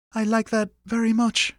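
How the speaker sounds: high-pitched mother holding back emotion; moved mother. A narrator's reading. Mother accepting her son's offer, moved. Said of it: "to cover the quaver in the words".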